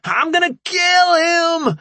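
slow, high, loud scream; staged rage. A narrator's reading. Rambo faking a fight, slow furious scream. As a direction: scream in mock fury. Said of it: "Rambo screamed in rage".

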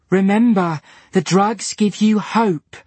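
high, loud and slow; overloud. A narrator's reading. Diana pleading loudly with Dylan. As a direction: push the hope too loudly. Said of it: "said Diana in an overloud voice".

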